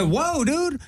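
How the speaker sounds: high, startled whoa dude. Retold or imitated. Comedian retells being startled by the sheriff, high and surprised. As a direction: pull back, caught off guard. His own surprise at cop.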